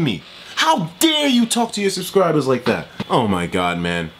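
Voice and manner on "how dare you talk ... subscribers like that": higher, indignant demand; outraged. Real, not acted. Vlogger scolds the YouTuber for how he treats fans. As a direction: scold him with disbelief.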